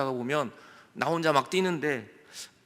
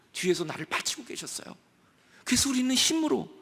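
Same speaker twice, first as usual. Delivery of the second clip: ordinary talk, nothing marked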